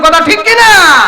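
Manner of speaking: very high, loud crowd call; rousing shout. Real, not acted. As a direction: shout for agreement, stretching the end. Demands a response from crowd. (জোর গলায় জনতার সায় চাওয়া)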